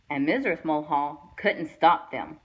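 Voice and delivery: ordinary delivery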